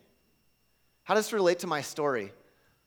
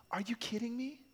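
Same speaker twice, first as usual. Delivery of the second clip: hushed, incredulous whisper; imagined whisper; 8 semitones higher, 8 dB quieter than usual. Pretend. Preacher imagines Jesus scoffing at his prayer, whispered. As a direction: breathe it as if Jesus says it.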